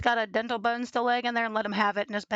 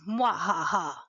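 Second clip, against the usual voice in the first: mock evil laugh; 3 semitones lower, 5 dB louder, slower than usual. Mock villain laugh after scare.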